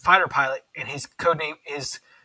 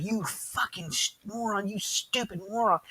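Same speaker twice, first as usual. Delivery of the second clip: mock road-rage cussing, higher but softer; retold cussing; 6 semitones higher, 6 dB quieter than usual. Scottish actor cussing at a reckless driver, mock angry. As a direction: cuss out a driver at half volume. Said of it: "I start cussing at him".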